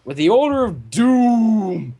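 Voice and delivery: slow ominous build to a loud cry of Doom!; mock dramatic doom; doom-laden. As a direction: drag it out like a horror trailer.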